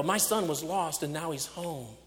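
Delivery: ordinary delivery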